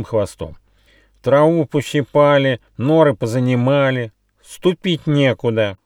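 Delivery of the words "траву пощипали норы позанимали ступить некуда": higher, louder grumbling bandicoot; aggrieved little animal; indignant complaint. A narrator's reading. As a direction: list the grievances in a raised whine. Bandicoot continues his complaint.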